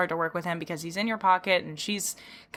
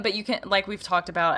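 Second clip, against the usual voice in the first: ordinary delivery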